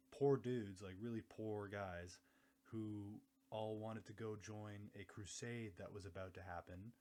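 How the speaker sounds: normal talking voice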